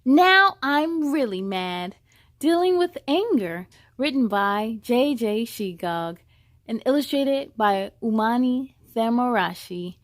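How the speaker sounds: storybook title reading; title read aloud. Acted. Reads title page aloud.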